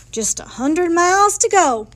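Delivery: high, loud proclamation; Daddy's declaration. A narrator's reading. Daddy announcing how far is left, loud declaration. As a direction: declare it out loud to the whole family. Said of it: "declared Daddy".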